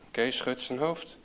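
plain reading voice

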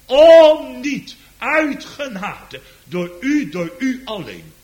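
fervent preacher climbing to a loud peak; preaching at full force. Acted. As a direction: proclaim it from the pulpit. Preaching the promise.

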